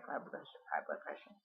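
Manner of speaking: a low, hushed whisper of her inner critic; whispered self-insult. Retold or imitated. Whispering her inner critic's put-down to herself. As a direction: breathe it out like a shameful thought.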